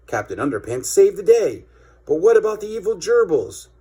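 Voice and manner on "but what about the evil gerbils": put-on high kid voice. Kid asks.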